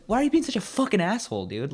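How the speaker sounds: high-pitched disbelief; acted wounded complaint to the man; indignant protest. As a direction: plead in exasperation. Acted quarrel over skateboard.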